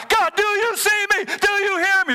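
high, loud plea to God; desperate yell. Retold or imitated. A person yelling up to God, high and loud. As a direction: shout it up, begging to be heard. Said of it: "You go to God".

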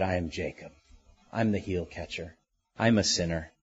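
low, subdued admission; humbled confession. Acted. Jacob admitting his name to the Lord, humbled confession. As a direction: own up quietly, voice dropped low.

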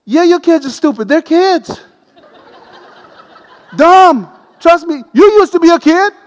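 comic preaching rant. Acted. Preacher teasing parents about their kids, high and loud. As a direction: rib the parents with a laugh.

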